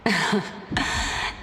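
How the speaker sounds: breathy chuckle. Acted. Sage teasing the sad visitor.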